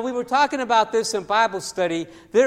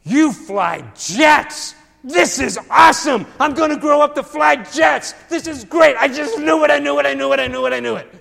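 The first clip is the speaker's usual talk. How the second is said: put-on loud, high, thrilled kid voice; 6 semitones higher, 11 dB louder than usual. Kid shouts to his grown-up self about jets, loud and thrilled. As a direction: burst out like an eight-year-old who just found out he'll fly jets.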